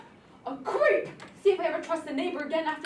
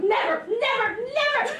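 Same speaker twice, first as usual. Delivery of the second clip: higher and louder with each never; escalating higher, louder nevers; rising shout; 8 semitones higher, 5 dB louder, slower than usual. Acted. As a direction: build to a yell. Daughter vows in quarrel.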